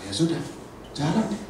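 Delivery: normal talking voice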